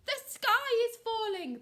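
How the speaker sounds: high, loud chick alarm; puffed-out panic, lightly done; urgent squeal from a puffing chick. A narrator's reading. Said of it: "puffed Chicken Licken".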